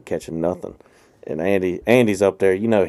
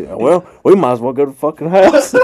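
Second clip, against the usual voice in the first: fed-up friend; 4 semitones higher, 5 dB louder than usual. Retold or imitated.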